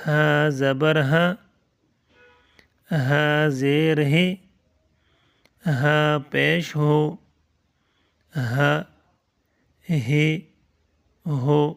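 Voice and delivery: each syllable drawn out with long pauses; slow drilled recitation. Shown as a demonstration. Teacher reciting slowly to children learning letters. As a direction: spell it out slowly for the class. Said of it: "یہ اس طرح پڑھیں گے۔".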